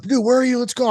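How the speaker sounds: retold impatient dad calling out. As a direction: call out as if rushing someone along. Acted impatient call to son.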